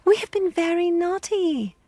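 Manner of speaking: put-on high, pleading children's voice. A narrator's reading. Children begging the village girl, high and pleading. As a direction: plead as eager little children. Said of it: "they cried".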